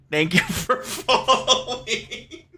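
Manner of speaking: high, slow thanks breaking into laughter; real laughter while thanking a follower; laughing thanks. Real, not acted. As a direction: try to say thanks through the giggles.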